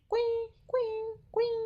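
high, squeaky mock beeps of a dead line; beeping phone imitation. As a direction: beep like a phone that has hung up.

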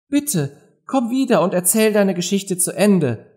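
high-pitched plea to come back; calling after, pleading. A narrator's reading. Alice calls after the departing mouse, pleading. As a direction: beg it in a high voice. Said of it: "rief Alice ihr nach".

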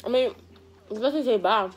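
chewing between words; talking around food; mouth full while talking. Real, not acted. Seen 2: Cheeks full and chewing after taco bites, while talking.

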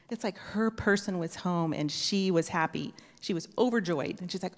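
ordinary talk, nothing marked

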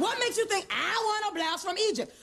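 fast, shouted tirade; indignant rant. Acted. Customer rants at clerk's suggestion.

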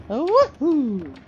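high Italian-plumber Mario voice. Acted. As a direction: bounce it up like Mario. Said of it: "I would do a Mario voice".

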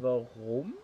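ordinary talk

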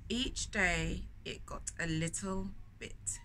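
put-on storyteller reading to children; story narration. A narrator's reading. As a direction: read it like a picture book to little ones. Reads the picture book aloud.

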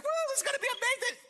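very high, fast squeal; hammed-up excited whoop, racing along; giddy excitement. Preacher plays disciple Peter at the parade, wildly excited. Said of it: "so excited".

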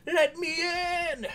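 high, loud, drawn-out yell; shouted demand. Pretend. Gamer at the gate crash, high drawn-out shout.

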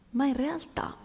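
whispered, leaning in; hushed setup before the punchline. Real, not acted. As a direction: drop to a secret whisper. Sudden hush before the twist.

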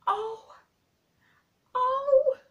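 put-on boy overwhelmed with delight; cried out in delight. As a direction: burst out with joy. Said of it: "Colin cried out in delight".

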